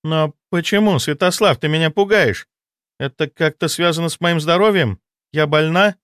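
a touch of fear in her questions; frightened woman. A narrator's reading. Anna frightened.

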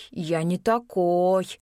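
drawn-out mincing voice, lightly done; slow, prissy, simpering drawl; sassy drag queen. A narrator's reading. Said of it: "протянул противным жеманным голосом".

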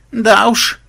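high, vexed near-tearful voice; near tears, vexed. A narrator's reading. Melissa glumly confirming the loss, near tears. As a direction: say it almost crying with annoyance. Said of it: "чуть не плача от досады".

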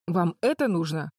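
babbling. A narrator's reading. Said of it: "пролепетал Дич".

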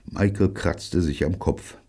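ordinary delivery, nothing marked

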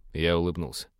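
ordinary delivery, nothing marked

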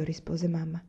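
plain reading voice

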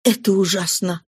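put-on impassioned young man, rising with feeling; Marius overflowing. A narrator's reading. Marius tells Cosette what Valjean did, impassioned. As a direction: pour out his gratitude to Cosette.

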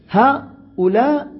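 هؤلاء stretched slowly on a rising tune; drawn-out demo. Shown as a demonstration. Teacher highlighting the form for the class, slow and rising. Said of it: "من".

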